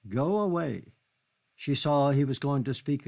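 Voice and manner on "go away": louder plea. Acted. Repeated, now louder.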